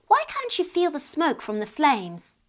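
lightly voiced curious child; curious little girl. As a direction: ask it wide-eyed like a little girl. Child asks tricky question.

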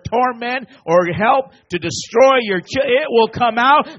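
building from firm to a high, loud cry; rising preacher's shout; preaching crescendo. Acted. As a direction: climb step by step into a shout.

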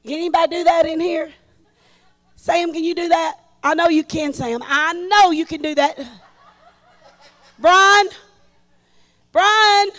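loud, fast, laughing call to the crowd; calling out excitedly. Real, not acted. Preacher loudly urging church members to dance. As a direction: egg them on to get up.

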